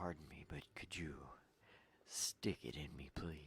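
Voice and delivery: unvoiced mock-polite whisper. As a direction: breathe the request in a whisper. Whispered joke request.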